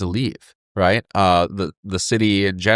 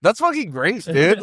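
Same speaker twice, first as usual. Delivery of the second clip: retold delight, much higher pitch; thrilled quote; 9 semitones higher than usual. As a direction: gush like you just got great news. Retelling his thrilled reaction.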